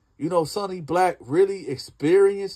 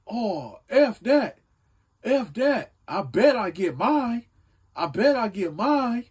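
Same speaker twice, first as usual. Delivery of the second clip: aggressive; 6 semitones higher than usual. Retold or imitated. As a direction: spit it out like a threat across the yard. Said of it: "the way he said it was so aggressive".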